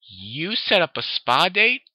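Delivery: slow, high, loud disbelieving question; acted astonishment, drawn out; incredulous. A narrator's reading. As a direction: stretch it out, rising in disbelief. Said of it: "looked at Applejack incredulously".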